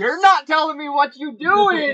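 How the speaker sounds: loud, high exasperated yell; frustrated shout. Acted. In-character frustration at the other player.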